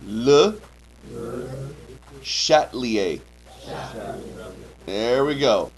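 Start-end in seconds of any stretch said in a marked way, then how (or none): 0.0-3.2 Le Chatelier said slowly in French style, luh shat-lee-AY; careful Le Chatelier. Shown as a demonstration. Teacher modelling Le Chatelier for students to repeat. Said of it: "repeat after me".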